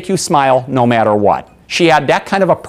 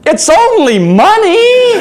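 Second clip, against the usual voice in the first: high, loud and drawn-out mock hilarity; imitated laughing; 5 dB louder, much slower than usual. Waitress to overcharged German customer, high laughing imitation. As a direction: stretch it out slowly, bursting into laughter. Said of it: "She starts laughing".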